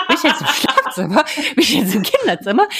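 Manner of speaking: mock panic waking in strange room; panicked confusion. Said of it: "panisch aufgewacht".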